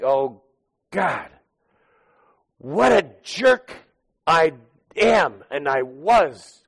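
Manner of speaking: high, slow cry of self-reproach; anguished remorse. Acted. Peter hearing the rooster, slow anguished self-blame. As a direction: Peter groaning at himself in anguish.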